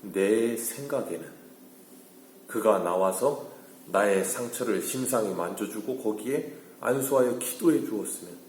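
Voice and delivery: normal talking voice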